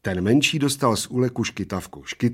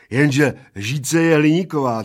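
raised, louder gloating retort; pleased-with-himself comeback; triumphant; 6 semitones higher, 8 dB louder than usual. A narrator's reading. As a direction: score the point triumphantly. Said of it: "triumfoval plešatec".

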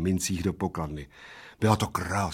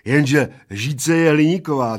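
triumphant; 5 semitones higher, 7 dB louder than usual. Acted. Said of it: "triumfoval Plešatec".

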